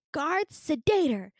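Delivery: high, loud, drawn-out order; mock commanding call. Staffer ordering the guard on an escapee, mock commanding call. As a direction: call out a slow, imperious command in imitation.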